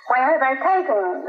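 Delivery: high, alarmed cry; understated scream, pitch jumping up in alarm; mild scream. A narrator's reading. Children's mother asking after her husband, mildly screamed. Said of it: "the children's mother screamed".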